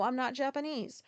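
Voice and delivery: normal talking voice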